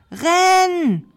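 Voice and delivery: high, loud, drawn-out yell; urgent cry. A narrator's reading. Knight fleeing the dragon, yelling urgently. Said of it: "rief der Ritter".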